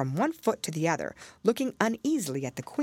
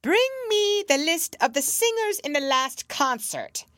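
high, louder queenly command; 12 semitones higher, 4 dB louder than usual. A narrator's reading. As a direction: order the officer coldly. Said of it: "she said to one of the officers".

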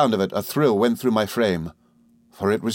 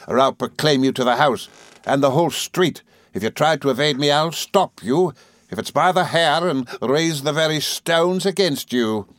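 higher, emphatic threat; Rosa Dartle, through set teeth; 6 semitones higher than usual. A narrator's reading. Rosa stopping Emily's escape, menacing. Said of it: "through her set teeth and with a stamp".

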